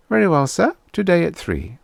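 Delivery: a crisp office clerk taking a booking; receptionist on the phone. A narrator's reading.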